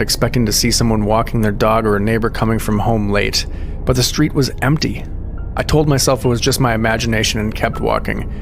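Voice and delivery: ordinary delivery, nothing marked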